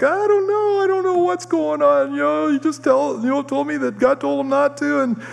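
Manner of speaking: very high-pitched imitation of tearful wailing; mock crying. Crying wife answering her mad mother, high mock wail. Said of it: "and Bobby's crying, and she's like".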